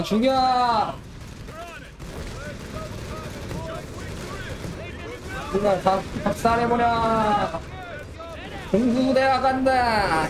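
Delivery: high, slow rallying war cry; battle roar. Acted. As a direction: roar the charge, dragging it out. Rallying troops in game.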